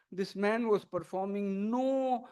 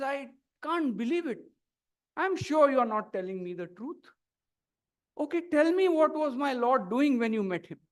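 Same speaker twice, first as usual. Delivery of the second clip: raised, louder, indignant protest; angry disbelief; 6 semitones higher, 6 dB louder than usual. A narrator's reading. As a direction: demand it like an offended priest. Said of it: "The Brahmin is very angry".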